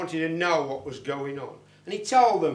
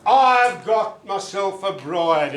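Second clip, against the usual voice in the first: 1 loud declaration; 7 dB louder than usual. Acted. Leprechaun declares his fate loudly.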